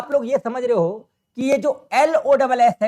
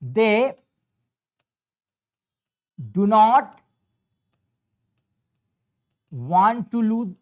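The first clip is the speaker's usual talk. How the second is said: they do not want to lose, very slow, word by word; slow dictation pace; 3 dB louder, much slower than usual. Shown as a demonstration. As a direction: read it out slowly for students. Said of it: "जिसको लूज पढ़ना है".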